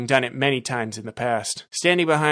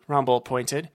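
plain reading voice

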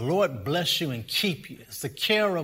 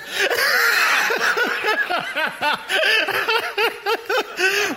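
long, high-pitched laughing fit; big laugh; 6 dB louder than usual. Real, not acted. Preacher cracking up at his own shirtless-preaching joke, big laugh. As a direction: burst into uncontrolled high laughter.

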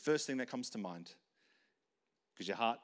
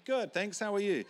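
mock cheery answer; 8 semitones higher, 6 dB louder than usual.

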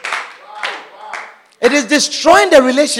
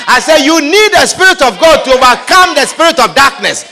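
normal talking voice

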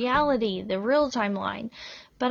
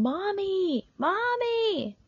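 mock child's scream; 9 semitones higher, slower than usual. A narrator's reading. Toddler seeing mother's face, high restrained mock scream. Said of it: "began screaming".

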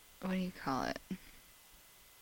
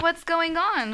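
alarmed; 11 semitones higher, 6 dB louder, slower than usual. Retold or imitated. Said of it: "I'm like".

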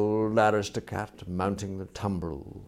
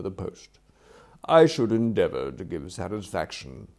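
lightly voiced butler, smooth and louder; deferential valet; 7 dB louder than usual. Valet hopes employer will consider his application, deferential. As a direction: repeat the request with courteous care.

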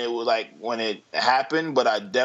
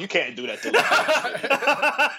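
laughing mock outrage; 7 semitones higher, slower than usual. Mock outrage defending band.

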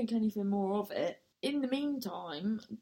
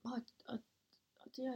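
whispered stammer; speechless whisper; 3 semitones higher, 9 dB quieter, much faster than usual. Retold or imitated. Whispering her stunned reaction to the woman's comment. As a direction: whisper it, lost for words. Stunned after being scolded.

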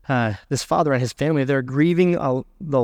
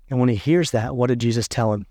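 ordinary delivery

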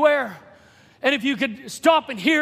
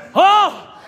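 loud, high cry of recognition; sudden revelation; 6 semitones higher, 6 dB louder, slower than usual. Acted. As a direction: cry out as it suddenly dawns on you. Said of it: "that was an instant revelation".

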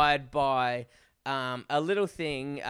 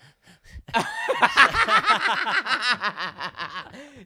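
long, high burst of helpless laughing; cracking up at the punchline; real loud laughter; 10 semitones higher, 7 dB louder than usual. Real, not acted. Host hearing the Chemist Warehouse offer, bursting into loud high laughter.

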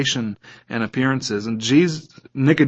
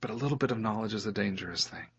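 hushed refrain; 5 semitones lower, 15 dB quieter, faster than usual. Acted. Refrain repeated softly.

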